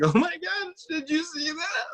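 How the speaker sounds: very high, squeaky mock sobbing; imitated crying. Injured husband overreacting to his wife, hammed-up tearful squeal. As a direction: wail it like an overwrought, tearful mess. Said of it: "crying, like".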